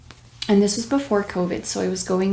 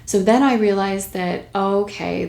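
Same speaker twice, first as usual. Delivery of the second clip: ordinary talk, nothing marked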